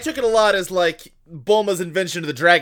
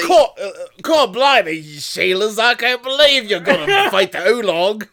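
hammed-up Australian accent, higher and louder; 6 semitones higher, 6 dB louder than usual. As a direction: play a jokey Australian bloke. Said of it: "I'm doing a perfect Australian accent".

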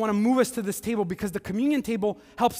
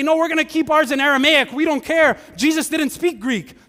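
much louder, higher mock defiant shout; mock stubborn protest; 8 semitones higher, 9 dB louder than usual. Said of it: "And they didn't say".

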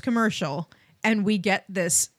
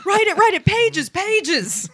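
high, loud urgent ordering; hammed-up panicked excitement; frantic writer; 11 semitones higher, 3 dB louder, slower than usual. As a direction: rush everyone on, half laughing. Acted frantic writers' room.

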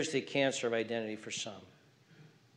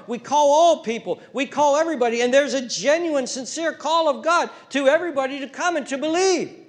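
loud, high sermon cry; full-force preaching; 10 semitones higher, 8 dB louder than usual. Real, not acted. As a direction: preach it to the back row.

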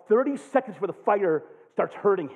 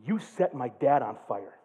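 low, soft retold accusation; 10 semitones lower, 5 dB quieter than usual. Kid confronts the preacher about her dad, low retold accusation. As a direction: quote her quietly and lower. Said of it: "She's like".

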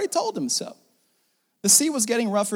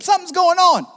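higher and louder, called out; shouted alarm; 8 semitones higher, 5 dB louder than usual. Real, not acted. Preacher to congregation, sounding the alarm with a shout.

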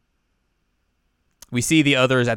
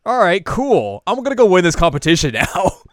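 loud, high burst of resolve; acted-out fired-up exit line; pumped up; 7 semitones higher, 9 dB louder than usual. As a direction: declare it with gung-ho energy. Acts character pumped to compete.

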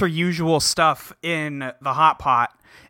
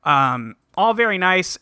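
ordinary delivery

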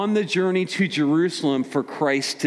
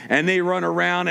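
ordinary talk

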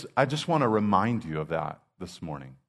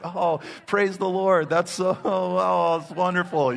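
high, slow, gushing mock delight with a laugh; sugary high put-on praise, stretched out; 13 semitones higher, 8 dB louder, slower than usual. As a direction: ham up the fake joy at their news. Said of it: "and you're like".

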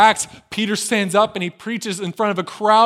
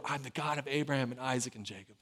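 softer and lower, hushed; soft reverent quote; 7 semitones lower, 16 dB quieter than usual. Acted. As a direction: let it fall quietly. Phrase repeated softly.

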